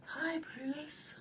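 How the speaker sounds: high, soft whispered reply; small fish answering in a high whisper; fish greeting. Retold or imitated. Quoting the fish greeting Bruce, high whisper. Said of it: "Finding Nemo".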